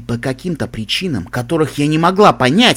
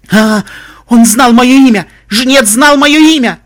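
high, loud panicked wail; 10 semitones higher, 9 dB louder, slower than usual. Acted. Narrator panics as the reaper knows her name, wailing. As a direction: cry out in mounting terror. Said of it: "прервала мою панику".

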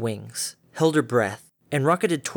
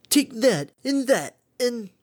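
high eager punching cries; 10 semitones higher, slower than usual. Acted. Derpy punching.

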